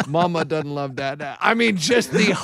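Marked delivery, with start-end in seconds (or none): 0.1-1.4 soft, lower, laughing through it; laughing mock sulk. Retelling Ray's drunken sulk to his wife, laughing softly. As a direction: giggle while quoting the sulk.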